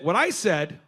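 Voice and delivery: ordinary delivery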